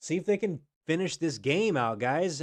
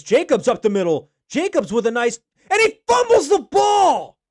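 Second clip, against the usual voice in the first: rising to a shout as he fumbles; excited play-by-play; 13 semitones higher, 16 dB louder than usual. Real, not acted. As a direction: call it louder and higher to a scream. Fumble call in live play-by-play.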